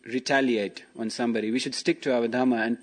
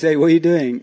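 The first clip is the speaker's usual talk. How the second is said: loud, higher, exasperated wake-up call; indignant tiger; 4 semitones higher, 7 dB louder than usual. Acted. Tiger rousing the sleeping bear, loud exasperated call. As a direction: jolt the sleeper awake with outrage.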